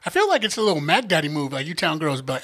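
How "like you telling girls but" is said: usual speaking voice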